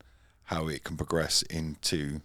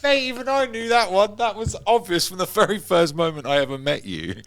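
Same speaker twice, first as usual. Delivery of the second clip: laughing through the words, high and loud; squealing with laughter at the obvious answer; 12 semitones higher, 7 dB louder than usual. Real, not acted. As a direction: crack up as you tease him.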